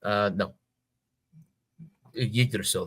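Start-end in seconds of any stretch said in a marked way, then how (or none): none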